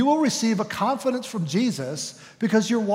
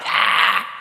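breathless high shriek of panic; panicked scream; 6 dB louder than usual. Acted. The flesh crying out at the hard step, scream. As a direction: let out a short frightened scream. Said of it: "But my flesh says".

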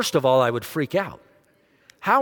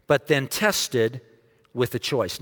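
slow, deliberate; 3 semitones lower, slower than usual. Shown as a demonstration. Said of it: "repeat of the point".

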